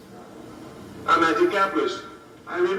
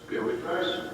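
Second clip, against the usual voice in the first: ordinary talk, nothing marked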